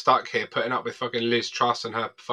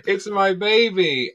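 slow, higher, doting coo; mock doting; 8 semitones higher, 4 dB louder, much slower than usual. Guest mimicking himself doting on the catalogue, slow and high. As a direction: coo over the catalogue like a proud parent. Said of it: "I'm like".

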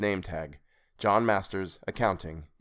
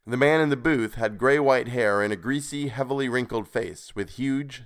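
ordinary delivery, nothing marked

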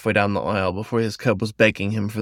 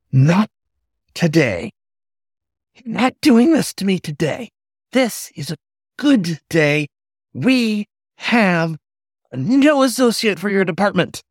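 kettle whistle voice; 9 semitones higher than usual. Acted. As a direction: hold back the fury. Manager forcing calm, still shrill.

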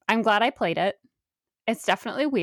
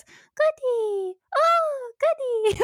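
put-on very high, tender child's call; little kid calling his dog; much slower than usual. Podcaster imitates the boy greeting his pet dog, very high. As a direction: coo the dog's name like a small boy. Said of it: "he goes".

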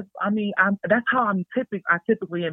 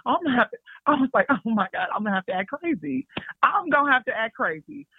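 raised voice, psyching herself up; higher, excited self-talk; 7 semitones higher than usual. Retold or imitated. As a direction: talk yourself into going wild. Deciding to act crazy.

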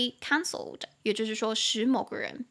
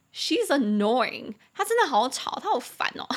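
hammed-up exasperation, joking; mock annoyed joke. Joking to friends about a chatty woman, mock annoyed. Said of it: "你就可以开玩笑说".

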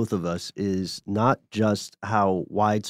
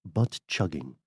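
put-on lower, softer posh British accent; 5 semitones lower, 5 dB quieter than usual. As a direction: say it like a proper English gentleman, posh British. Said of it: "in a posh British voice".

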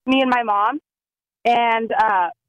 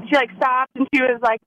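ordinary delivery

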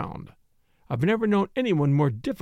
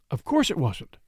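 ordinary delivery, nothing marked

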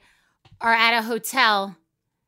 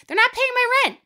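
much higher, mocking defiance; defiant rant; 14 semitones higher, faster than usual. Real, not acted. Explaining why she rants, high defiant scorn.

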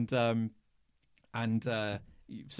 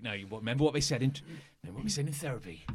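very fast muttered self-reminder; hurried self-talk; much faster than usual. Acted. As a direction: rattle it off fast to yourself. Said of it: "calm himself down".

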